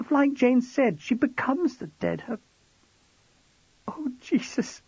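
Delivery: high woman's voice, shaken and upset; a frightened wife pouring it out; tearful young wife. Acted. Narrator voices Jackie.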